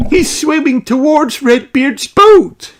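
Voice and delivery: put-on high, loud little girl cry; Holly's alarm. Holly warns about the fish. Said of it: "cries Holly".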